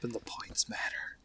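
hushed joke whisper; breathy whispered punchline. Pretend. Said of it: "whispered twist on the catchphrase".

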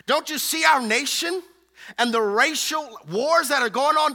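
exasperated plea. Acted. Martha demands Jesus care about her burdens, exasperated plea.

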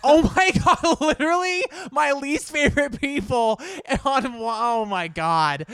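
loud, high, laughing disbelief; laughing shriek. Real, not acted. Podcast host hears her least favorite guest, laughing shriek.

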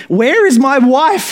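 very high, loud scream. A narrator's reading. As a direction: scream it into the darkness. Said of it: "he screamed".

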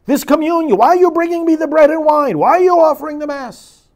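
high, loud, fed-up rebuke; an imagined Jesus shouting, tired of the rituals; exasperated Jesus shouting. Pretend. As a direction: rail at the people like an exasperated Jesus. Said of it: "Jesus shouting those words to us".

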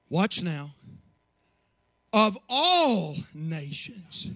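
slow, low, measured Bible reading; solemn scripture reading. Acted. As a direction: read each phrase like a proclamation. Reads scripture slowly.

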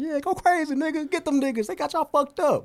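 high, excited egging on; hyped bystander. Retold or imitated. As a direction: cheer them on from the sidelines. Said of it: "we'd be on the sidelines like".

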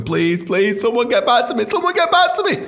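beg, building to a squeak; mock desperate pleading.

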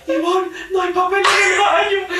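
lightly voiced janitor shouting; janitor calling. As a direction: shout it to the mother next door. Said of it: "La conserje llegó".

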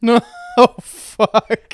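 loud, high shocked groan with laughter; real horrified, laughing disbelief; appalled amusement. Real, not acted. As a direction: cry out at a brutal punchline. Listener reacts to harsh punchline.

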